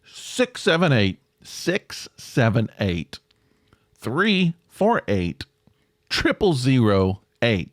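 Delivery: slow, spaced digits for listeners to note; slow dictation. Real, not acted. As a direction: spell out the phone number slowly, with gaps. Repeats phone number for listeners.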